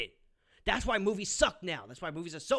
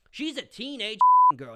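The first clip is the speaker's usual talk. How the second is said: repeated much louder and higher, hammering it home; 7 semitones higher, 12 dB louder than usual. Real, not acted. Reviewer yelling at the critic Jenna, loud emphatic repeat. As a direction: shout it back at her the second time.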